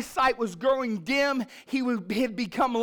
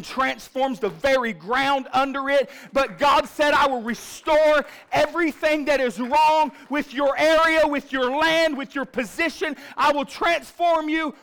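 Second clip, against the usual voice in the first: loud, driving preacher climax, much louder and higher; fiery revival preaching; preaching at full force; 5 semitones higher, 8 dB louder than usual. Acted. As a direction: whip up the congregation with each line.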